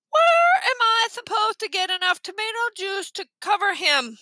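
high, exasperated congested question; stuffed-nose voice. A narrator's reading. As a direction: demand an answer through a pinched nose. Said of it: "they had the worst colds".

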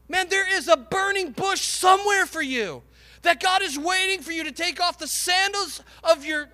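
loud, high, driving sermon climax; preaching at full force. Acted. Preacher urging the church not to give up, full-force preaching. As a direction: shout the call over the congregation so no one gives up.

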